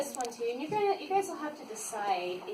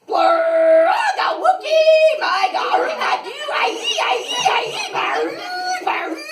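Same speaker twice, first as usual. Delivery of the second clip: loud, high, laughing gibberish; silly nonsense shouts; 11 semitones higher, 10 dB louder than usual. Pretend. The book forces silly sounds for the kids, shouting. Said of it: "makes silly sounds like".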